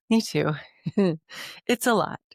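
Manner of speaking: usual speaking voice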